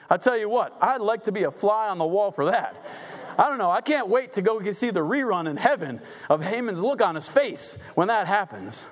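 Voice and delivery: usual speaking voice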